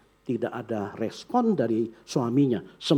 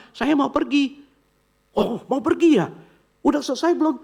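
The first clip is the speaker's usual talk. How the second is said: high, lightly voiced grandma; a hint of an old grandma asking; chirpy grandma voice, higher; 13 semitones higher, 3 dB louder than usual.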